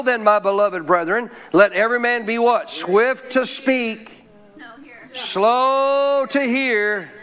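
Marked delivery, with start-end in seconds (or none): 2.7-7.1 drawn-out reversed verse. Shown as a demonstration. Verse recited back to front.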